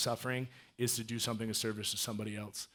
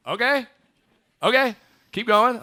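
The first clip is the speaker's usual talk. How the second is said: much higher and louder, stunned; surprised okay; 11 semitones higher, 14 dB louder than usual. Real, not acted. Hearing Nate drives for Lyft, loud high surprise. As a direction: react as if the story just floored you.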